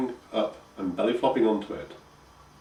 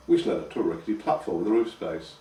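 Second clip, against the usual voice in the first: usual speaking voice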